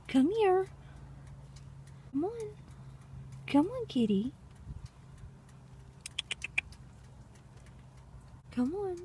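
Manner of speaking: very high, soft coaxing call; cat-calling voice. Real, not acted. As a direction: sweet-talk the kitty in a high sing-song.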